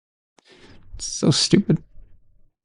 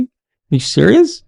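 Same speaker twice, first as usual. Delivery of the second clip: ordinary talk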